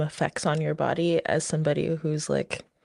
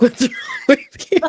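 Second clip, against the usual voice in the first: loud, high mock incredulity through laughter; retold side-eye disbelief; 8 semitones higher, 10 dB louder than usual. Product manager to coder in labor, laughing mock disbelief. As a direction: imitate an appalled colleague, laughing as you tell it. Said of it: "she definitely side-eyed me".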